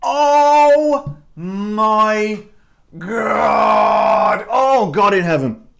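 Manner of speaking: loud cry of delight at the taste; overwhelmed exclamation. Real, not acted. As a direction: gasp it out as if the flavour floored you. First sip of the gin.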